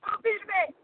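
high-pitched fake Portuguese accent; attempted Portuguese accent. Shown as a demonstration. Woman insisting on her Portuguese attempt, badly faked accent. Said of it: "give us your best Portuguese accent".

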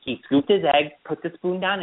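usual speaking voice

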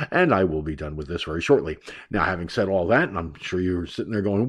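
usual speaking voice

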